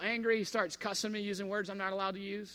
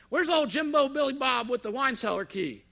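mocking consultant; 4 semitones higher, 5 dB louder than usual. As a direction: taunt like a smug boss. Mocking consultant quoted in story.

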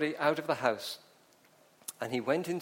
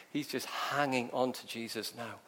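ordinary delivery

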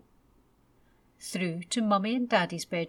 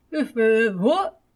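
startled awake; 3 semitones higher, 10 dB louder than usual. A narrator's reading. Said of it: "said his daddy, who had been sound asleep".

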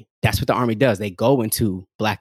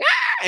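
gremlin screech. Retold or imitated. Gremlin screaming when it multiplies.

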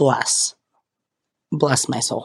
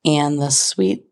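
ordinary talk, nothing marked

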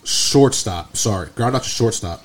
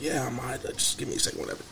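quiet, winded brush-off after taking a hit; hurt and quiet; 14 dB quieter, faster than usual. Retold or imitated. Hit runner tells the teammate he's fine, softly and hurt. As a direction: barely get the words out, playing it off.

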